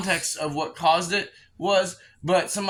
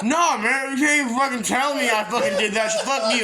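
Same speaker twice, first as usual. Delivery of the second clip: higher-pitched mock drunken outburst; mad drunk; 5 semitones higher, 3 dB louder than usual. Drunk James denying it to his friends, imitated angry protest. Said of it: "He's getting mad".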